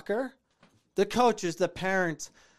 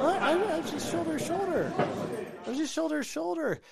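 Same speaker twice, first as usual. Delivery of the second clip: higher, pleading fake-innocent protest; mock player excuse; 14 semitones higher, faster than usual. Player mimicked protesting his innocence to the referee, in a high pleading voice. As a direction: whine like a caught player insisting it was fair.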